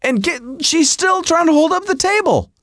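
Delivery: high-pitched, indignant protest; exasperated retort. Retold or imitated. Husband retorts to wife who has been yelling, exasperated. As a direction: argue back in exasperation, pitch way up.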